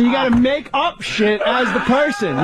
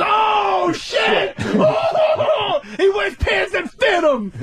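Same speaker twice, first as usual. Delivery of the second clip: crowd whooping; 11 semitones higher, much slower than usual. Retold or imitated. Everybody mocking Keith's pants, high shouted. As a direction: holler like the whole room. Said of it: "And everybody goes".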